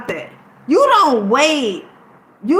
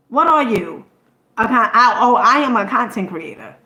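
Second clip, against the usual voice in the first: ordinary talk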